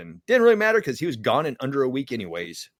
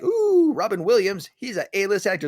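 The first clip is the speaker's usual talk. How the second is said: a high, gushing "Ooh" that squeals up, then fan talk; mock starstruck; 6 semitones higher than usual. Fan gushing over a star, high mock awe. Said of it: "it's not like".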